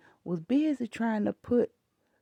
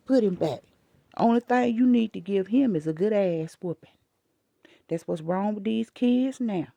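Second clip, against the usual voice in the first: louder, bossy telling-off; retold lecture to the grandfather, louder; 4 dB louder than usual. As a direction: order the grandfather around. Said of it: "I said".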